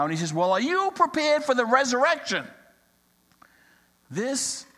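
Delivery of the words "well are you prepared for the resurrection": higher and louder, exasperated push; pressing the question, losing patience; frustrated. Acted. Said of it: "The pastor is getting frustrated now".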